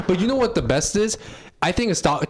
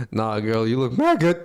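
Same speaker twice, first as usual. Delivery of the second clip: seductive voice; 7 semitones lower than usual. Retold or imitated. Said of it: "my voice gets a little bit deeper".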